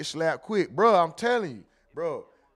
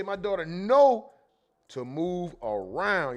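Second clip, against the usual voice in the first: slow drawn read; slower than usual. Retold or imitated. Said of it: "the biggest little mama did say".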